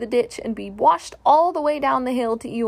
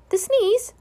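high, squeaky little Piglet voice; small puzzled creature, pitched way up; timid small creature; 12 semitones higher, 4 dB louder than usual. Acted.

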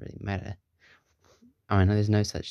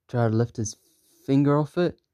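ordinary delivery, nothing marked

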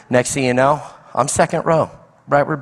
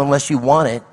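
ordinary talk

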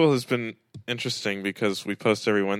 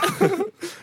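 high laugh. Real, not acted. Laughing during mock call.

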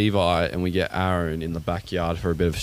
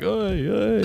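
calling out; 11 semitones higher than usual. Retold or imitated. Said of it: "She's like".

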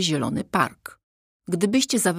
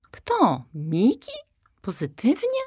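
incredulous; 6 semitones higher than usual. Acted. Zosia's incredulous questions.

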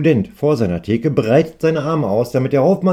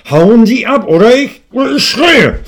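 high, loud scream getting higher; 11 semitones higher, 10 dB louder than usual. Acted. Shopkeeper screaming at the customer to leave. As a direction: lose it completely and yell. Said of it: "Seine Stimme wird lauter und lauter".

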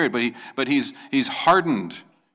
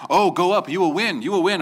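eager prophets; 8 semitones higher, 5 dB louder than usual. Retold or imitated. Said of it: "and Ahab hears".

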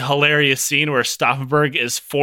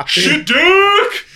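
very high jeering call; high mocking heckle; 13 semitones higher, 6 dB louder than usual. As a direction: shout it from the back. Heckler at back of rally.

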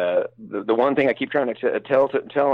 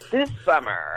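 put-on laughing parody of a Valley Girl announcer; Valley Girl trailer; 3 semitones higher, slower than usual. Shown as a demonstration. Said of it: "The Valley Girl movie trailer".

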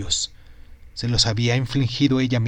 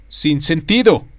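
annoyed, louder growl of a doctor; angry grumble; 4 semitones higher, 6 dB louder, slower than usual. Acted. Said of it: "Enojado, el médico en jefe se puso el sombrero y gruñó".

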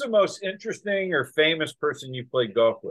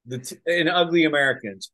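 normal talking voice

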